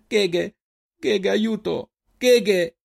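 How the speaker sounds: high-pitched, urgent calling; cry for help. A narrator's reading. Selin dragged away calls back to Reynal, cry for help. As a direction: call out for rescue. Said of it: "gridò in risposta Selin".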